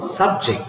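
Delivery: subject stressed up front, SUB-ject; "subject" with SUB high, then falling; stress on first syllable. Shown as a demonstration. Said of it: "how to pronounce this word".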